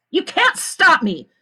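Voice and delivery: high, loud and slow defiance; snapping. A narrator's reading. The lawyer snaps back at Mister Sir, high and loud. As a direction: put the guard in his place. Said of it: "she snapped".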